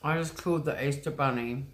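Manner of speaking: ordinary delivery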